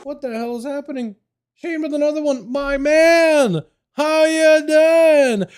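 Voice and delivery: real startled excitement at the alerts; surprised hype. Real, not acted. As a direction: stretch out the greeting, amazed and high.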